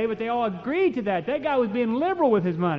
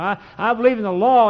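ordinary talk

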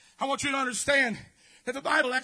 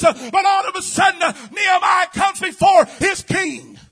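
loud, building preacher's cry; full-force preaching; 2 semitones higher, 7 dB louder than usual. Acted. Preacher crying out to the congregation, full force. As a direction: drive it home to the church.

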